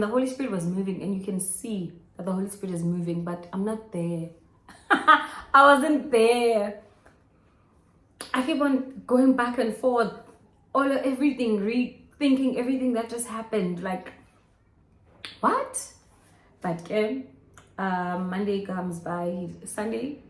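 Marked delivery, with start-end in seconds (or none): 4.9-6.8 laughing, loud and slow. Real, not acted. Admitting she missed the sermon, laughing. Laughing about missing the service. 15.4-15.7 squeaky high what; incredulous. Real, not acted. Rethinking the fight, a squeaky high disbelieving what.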